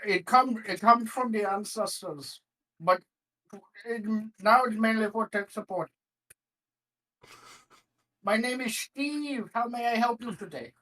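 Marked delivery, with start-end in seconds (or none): 2.8-10.7 put-on Indian telemarketer accent. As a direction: greet a customer in a hammed-up Indian accent. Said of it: "It is strictly telemarketer Indian Bangladeshi".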